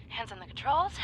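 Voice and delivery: ordinary talk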